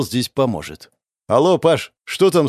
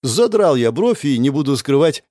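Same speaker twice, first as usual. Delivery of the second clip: ordinary delivery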